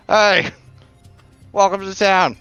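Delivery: high, loud barker call. Acted. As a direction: hail passersby like a carnival barker.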